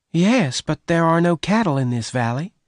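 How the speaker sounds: put-on a shy girl unsure of her past; Bess voice. Bess answers Venters.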